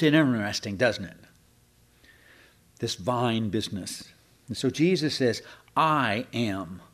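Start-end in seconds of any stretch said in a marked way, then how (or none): 5.7-6.8 slow, weighted I AM; "I" and "am" set apart, deliberately; emphatic. Retold or imitated. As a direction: give both words of "I am" separate weight. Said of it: "And it's emphatic".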